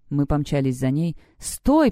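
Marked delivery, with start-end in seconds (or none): 1.4-1.9 high, sharp narrated command; demanding. A narrator's reading. Thunder demands that fleeing Celeste stop, a high-pitched command. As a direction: order a runner to halt, pitch jumping up. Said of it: "потребовала Тандер".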